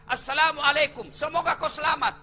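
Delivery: rising into loud shouted blessings; preacher builds the greeting to a full cry; fervent full salam. Shown as a demonstration. Loud, building fervent salam. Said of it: "Tiga isi dalam doa".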